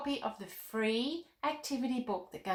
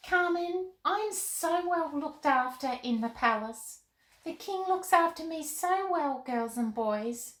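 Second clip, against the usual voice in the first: put-on high, bright little girl puppet voice; Molly the puppet chatting with Carmen, in a high girl voice; 9 semitones higher than usual.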